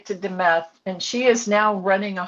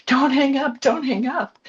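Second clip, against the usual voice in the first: retold scream; 7 semitones higher than usual. Son pleading on a night collect call, retold scream. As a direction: imitate a desperate plea, pitched high at usual volume. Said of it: "he was screaming on the other end".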